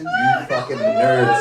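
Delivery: high, slow squealing jeer at nerds; a drunk partier teasing; high drawn-out laughing taunt; mock drunk jeer. Mocking party crowd.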